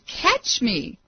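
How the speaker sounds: understated narrated plea for help; mild scream. A narrator's reading. Falling boy begs God to catch him, mild cry. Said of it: "he screamed".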